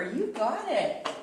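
usual speaking voice

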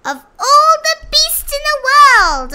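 high, booming rabbit bragging; loud boast. A narrator's reading. Rabbit boasting to the world from his hilltop, loud and high. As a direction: puff up and proclaim it loudly. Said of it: "he boasted out loud".

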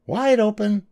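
very high-pitched urgent command; little mouse wife calling out; mock yell. A narrator's reading. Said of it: "yelled his wife".

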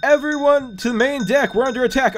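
calling out an attack; acted alarm call. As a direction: raise the alarm across the deck. Reads pirate crew's alarm.

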